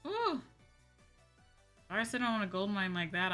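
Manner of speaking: ordinary talk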